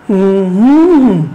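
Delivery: low closed-mouth hum with a rise and fall in the middle; hummed stress pattern. Shown as a demonstration. Teacher shows students the stress of umbrella, hummed. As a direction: hum the melody of a three-syllable word, middle stressed. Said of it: "আমার সাথে করতে পারো এভাবে".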